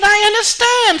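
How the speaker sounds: student answering the instructor in a high squeak; squeaky student voice. Retold or imitated. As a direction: voice the hapless student, high and meek.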